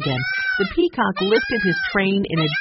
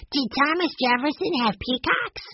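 put-on high, boyish question; a small boy asking with wide eyes; curious little boy Benny; 8 semitones higher than usual. A narrator's reading. Youngest boy asking the guide, high and curious.

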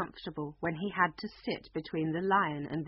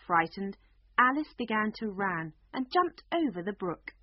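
usual speaking voice

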